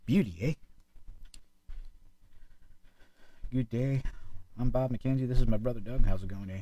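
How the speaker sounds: loud gruff Canadian hoser voice; Canadian hockey bro. Retold or imitated. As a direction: do a Bob and Doug McKenzie bit. Bob and Doug McKenzie impression.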